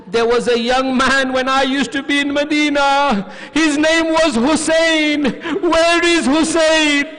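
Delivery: high, loud cry building in intensity; anguished questioning. Retold or imitated. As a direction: cry out the question, drawing out the words. Hind's anguished question in lament sermon.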